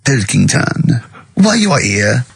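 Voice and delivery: a hint of a French emperor; French accent. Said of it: "I don't know why he's French".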